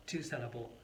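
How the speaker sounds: ordinary talk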